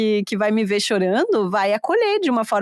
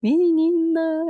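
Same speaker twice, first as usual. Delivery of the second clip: arrastado; 6 semitones higher, much slower than usual. Real, not acted. Desabafo sobre não parar de chorar.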